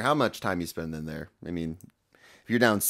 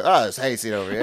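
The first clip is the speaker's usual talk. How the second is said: louder old-time New Yorker impression; nineteen-thirties New Yorker; 3 semitones higher, 6 dB louder than usual. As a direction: imitate a nineteen-thirties city slicker, loud. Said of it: "it's, like, nineteen thirty".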